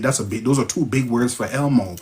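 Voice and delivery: ordinary talk, nothing marked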